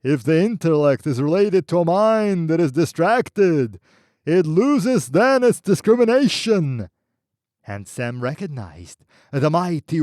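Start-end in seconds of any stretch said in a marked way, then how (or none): 0.0-6.9 roaring voice. Acted. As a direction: roar the verse at his back. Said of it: "roaring at his back".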